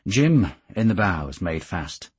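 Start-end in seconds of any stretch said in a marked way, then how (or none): none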